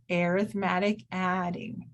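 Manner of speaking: slow, soft, leaning on the opening sounds of "Arithmetic adding"; stressed alliteration. Shown as a demonstration. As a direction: draw out "Arithmetic adding" so the repeated first sound rings. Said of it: "Do you hear the alliteration?".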